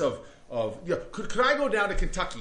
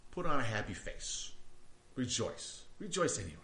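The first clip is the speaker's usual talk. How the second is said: mock dismissive; 4 semitones lower, 9 dB quieter than usual. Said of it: "is Paul saying".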